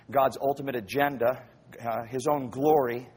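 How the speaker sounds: ordinary talk, nothing marked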